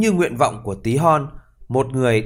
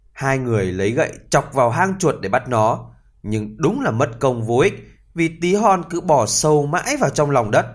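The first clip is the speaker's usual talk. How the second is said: ordinary delivery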